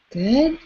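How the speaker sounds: big audible sigh, then a high Good; dramatic sigh. Shown as a demonstration. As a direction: let out a big, long sigh. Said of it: "big sigh".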